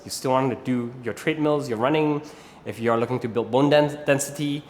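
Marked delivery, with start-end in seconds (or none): none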